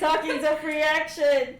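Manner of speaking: loud mocking repeat; mocking echo. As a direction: parrot it back teasingly. Echoed in mocking voice.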